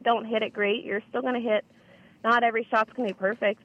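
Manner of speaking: ordinary talk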